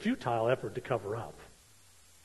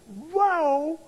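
mock surprise; 7 dB louder than usual. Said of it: "They're like".

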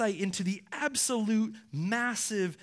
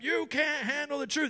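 higher-pitched movie outburst; fired-up movie speech; 6 semitones higher than usual. Acted. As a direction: deliver it like a fired-up courtroom showdown. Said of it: "speeches that get us all fired up".

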